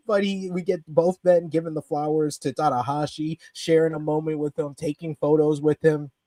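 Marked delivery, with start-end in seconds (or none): none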